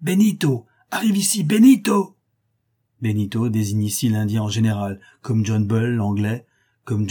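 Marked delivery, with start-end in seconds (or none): 0.0-2.2 loud, much higher furious call; calling the host in a rage; furious shout. A narrator's reading. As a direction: yell it to wake the whole house. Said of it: "d'une voix furieuse qui met en l'air toute la maison".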